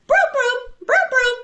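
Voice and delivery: loud, high-pitched play call. Retold or imitated. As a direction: squeal it out high and loud, in a playful imitation. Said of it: "in a very high voice".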